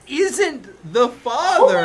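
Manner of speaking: milk the reveal slowly; mock dramatic reveal. Dramatic reading of twist.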